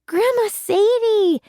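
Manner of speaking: high, loud, drawn-out happy cry; excited cry. Acted. Girl greeting her grandma, crying out with joy. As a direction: call her name out with delight. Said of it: "I cried".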